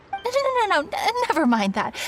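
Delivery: flustered backtracking. Acted. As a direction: catch yourself and wave it away in a rush.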